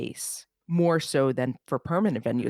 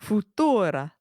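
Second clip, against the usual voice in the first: put-on Italian accent; 5 semitones higher, 4 dB louder, slower than usual. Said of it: "I'm gonna do this ridiculous Italian accent".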